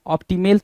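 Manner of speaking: op-ti-MEL; wrong optimal. Shown as a demonstration. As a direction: say ऑप्टिमल with "mel" in place of "mul". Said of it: "थोड़ी mark करोगे". (ऑप्टिमल को "ऑप्टिमेल" बोलें)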